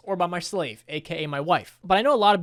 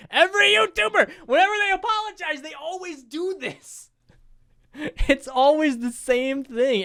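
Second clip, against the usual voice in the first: high, squealing comic exasperation; exasperated outburst; 10 semitones higher, 4 dB louder than usual. Real, not acted. YouTuber ranting at identical apology videos, in high-pitched exasperation. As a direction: stretch it out high in disbelief.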